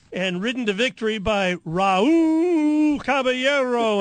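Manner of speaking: slow, drawn-out track announcer call; race caller impression. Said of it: "but he would say".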